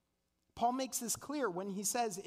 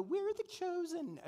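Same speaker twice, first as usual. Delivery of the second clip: high, smug, haughty quote; mock the arrogant Presbyterian; 10 semitones higher than usual. Preacher mocking smug Presbyterians, in a haughty voice. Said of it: "you know, that voice".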